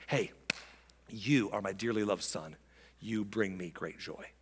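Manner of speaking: low, soft and tender; God addressing Jesus. Acted. God to his Son at the baptism, intimately tender. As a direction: turn aside and speak privately to a son.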